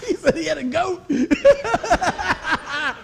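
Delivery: loud words through laughter; laughing. Real, not acted. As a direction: talk while laughing hard.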